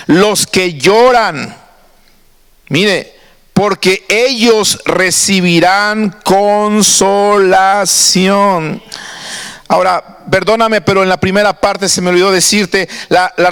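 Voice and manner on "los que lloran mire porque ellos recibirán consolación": each word drawn out slowly and solemnly; slow scripture reading. Shown as a demonstration. Preacher reading the beatitude to the church, slow and solemn. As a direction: lay the verse down slowly, word by word.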